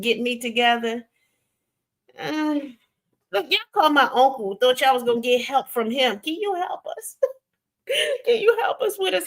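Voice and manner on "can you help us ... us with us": high-pitched mock pleading, breaking into laughter; mocking plea. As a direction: imitate them begging for help, laughing. Mocks people pleading with her uncle.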